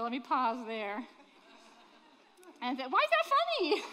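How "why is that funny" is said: high, loud mock outrage at the laughter; mock indignant. Preacher teasing the laughing congregation, mock indignant. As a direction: play offended, pitch way up.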